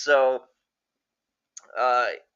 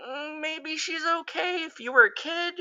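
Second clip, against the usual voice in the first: put-on hesitant kid; 14 semitones higher than usual. Imagined kid's trusting thought.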